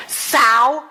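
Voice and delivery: very loud retort, hurled back; retold roadside shout, full volume; yelled comeback. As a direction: bellow it back at the other driver. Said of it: "yelling at her".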